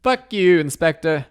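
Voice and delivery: high, loud parting insult; high-pitched comic holler; shouted curse. Acted. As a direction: yell it as you float away. Character curses while floating away.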